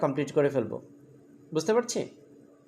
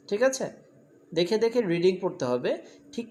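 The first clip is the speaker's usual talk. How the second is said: usual speaking voice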